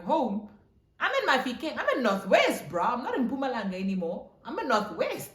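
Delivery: high, loud incredulous protest; indignant disbelief. Retold or imitated. Acted indignant answer to boyfriend.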